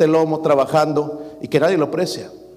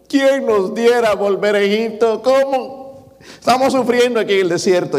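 crying Israelites; 6 semitones higher than usual. Pretend. Preacher to his church, mimicking Israelites crying to return to Egypt, high mock wail. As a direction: wail it like a crowd sobbing in the desert.

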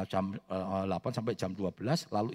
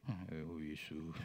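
very soft, slow, low murmur; feeble mock prayer; 7 semitones lower, 13 dB quieter, much slower than usual. Preacher mimicking someone praying only five minutes, feeble hushed prayer. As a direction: let the prayer sag, hushed and drawn out.

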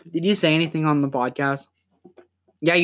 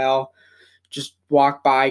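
ordinary delivery

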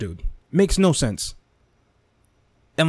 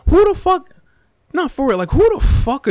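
much higher, louder furious yell; outraged shout; 7 dB louder than usual. Real, not acted. At critics of Joe Rogan, outraged yell. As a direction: explode at the critics. Peak of angry rant.

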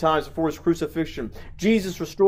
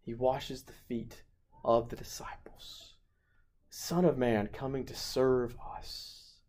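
reverent whisper; 3 semitones lower, 12 dB quieter than usual. Real, not acted. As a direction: fade to a reverent hush. Hushed reverent close.

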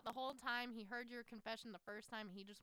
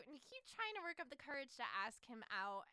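ordinary talk, nothing marked

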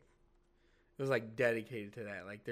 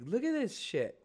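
much higher excited exclamation; excited pointing; 9 semitones higher, 3 dB louder than usual. Retold or imitated. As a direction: urge her to look, worked up. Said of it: "And I was like".

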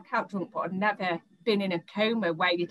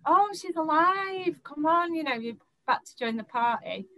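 mocking boyfriend; 9 semitones higher than usual. Boyfriend teasing her on arriving downstairs, high mock cheer. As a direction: play the boyfriend sarcastically greeting her awake.

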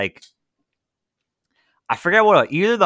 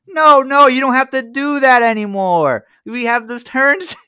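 higher, louder, insisting no no; exasperated salesman; 10 semitones higher, 7 dB louder than usual. Retold or imitated. Salesman pleading with the prank caller, high and loud. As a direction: urge him to stop, pitched up and loud.

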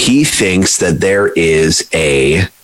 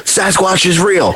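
mock believer; 11 semitones higher than usual. Mocking a gullible believer.

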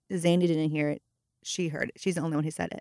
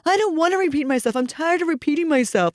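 higher, hurried refusal; imitated fed-up child; 8 semitones higher than usual. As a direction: mimic a worked-up kid protesting. Child refuses again, worked up.

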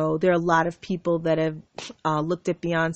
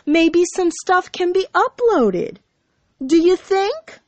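high-pitched, pointed sarcasm; sarcastic; 10 semitones higher, 3 dB louder than usual. Real, not acted. Telling off the ex who holds her devices, sarcastic. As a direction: climb high into an incredulous jab.

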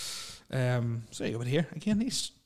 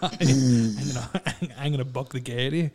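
laughing; 5 dB louder than usual. Real, not acted. Joking threat, laughing through it.

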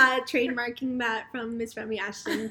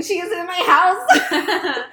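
laughing excitedly; 7 semitones higher, 10 dB louder, slower than usual. Real, not acted. Host excited about her guest.

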